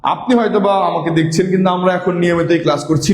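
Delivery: normal talking voice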